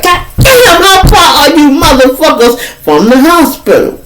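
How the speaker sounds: high mock outrage; exasperated. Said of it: "I'm sitting there like".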